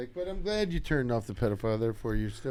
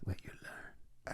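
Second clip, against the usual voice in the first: whisper; 17 dB quieter, slower than usual. Acted. Joking call-and-response about school.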